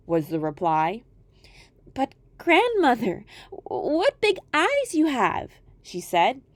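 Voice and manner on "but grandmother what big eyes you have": put-on high, slow, wide-eyed girl voice; a child amazed at grandmother; wondering little girl.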